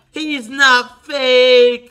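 loud, drawn-out, slow mocking whine; loud slow whine on "He's not fake."; mock whiny insistence. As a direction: stretch out "He's not fake." like a pouting kid. Mocking her insistence from chat.

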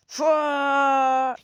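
loud attack shout; battle yell. Acted. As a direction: throw a punch with a yell. (saldırı çığlığı)

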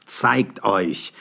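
shouted challenge; challenging shout. A narrator's reading. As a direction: dare them to show themselves. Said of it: "rief ich".

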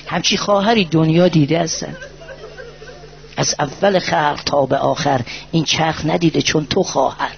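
normal talking voice

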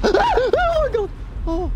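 high, loud terrified scream-laugh; shrieking fright. Real, not acted. Player startled by a lamp, shrieking. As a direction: shriek at the jump scare. Jump scare in horror game.

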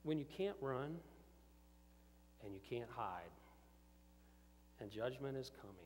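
ordinary delivery

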